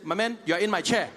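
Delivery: loud, blunt and matter-of-fact; blunt South African. Retold or imitated. South African telling a stranger he's in their seat. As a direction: tell him straight it's your chair. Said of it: "The person would have just said".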